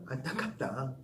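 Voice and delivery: quiet, sighing pity; soft pity. Shown as a demonstration. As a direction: murmur it with gentle regret. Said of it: "계속해서".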